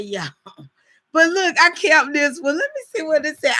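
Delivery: high, startled and amused, checking the card; surprised disbelief. Real, not acted. Wife discovers her own card to her husband, with high surprised disbelief. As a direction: she just realised she grabbed the wrong card.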